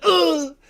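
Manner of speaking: high, loud mock grunt of being shot; hammed-up pained grunt; imitated grunt. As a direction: yelp like a hit man in a comedy bit. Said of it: "he's like".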